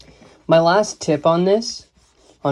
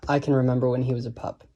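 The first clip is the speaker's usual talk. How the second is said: fast, skipping verse rhythm, softer; quick, tripping line; 5 dB quieter, much faster than usual. Shown as a demonstration. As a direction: rattle it off lightly and softly. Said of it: "the second line is difficult to say slow".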